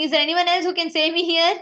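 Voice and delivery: panicked man calling out for anyone else; retold shouting. Man on the cliff branch shouts for any other rescuer. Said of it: "Then he'll start shouting".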